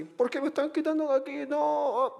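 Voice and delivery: high-pitched "why me?" complaint; protesting cartoon characters. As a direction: voice tiny victims objecting in a high pitch. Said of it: "ellos están así".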